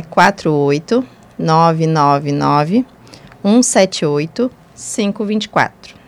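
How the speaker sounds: slow, spaced-out phone number; slow and deliberate. Real, not acted. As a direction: read each digit slowly and clearly. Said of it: "vou falar bem pausadamente".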